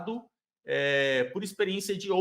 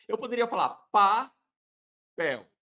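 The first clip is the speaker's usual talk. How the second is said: slow, louder "papel" set against "palavra"; contrasting example; 5 dB louder than usual. Shown as a demonstration. Lecturer offers a different word to compare. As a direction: lean on "papel" as the new example. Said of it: "não é palavra, é papel".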